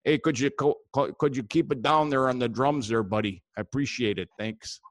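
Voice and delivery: folksy upper-Midwest Wisconsin character; thick Wisconsin up-north accent. Acted. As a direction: ramble like a Wisconsin fishing buddy. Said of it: "I got a guy up there in, uh, Wisconsin".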